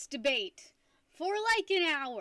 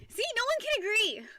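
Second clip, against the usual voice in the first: excited outburst; 9 semitones higher, 4 dB louder than usual. Real, not acted. Streamer reacting to split chat.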